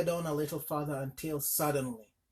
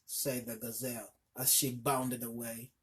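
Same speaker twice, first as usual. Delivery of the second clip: ordinary delivery, nothing marked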